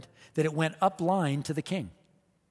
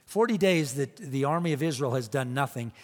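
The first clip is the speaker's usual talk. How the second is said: ordinary talk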